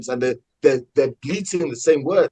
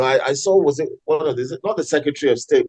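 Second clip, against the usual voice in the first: normal talking voice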